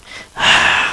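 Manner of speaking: a harsh breath squeezed from the throat; throaty pressured breath. Shown as a demonstration. Teacher showing throat pressure like a horror sound. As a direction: push air hard through a tight throat. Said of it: "just getting the pressure in the throat".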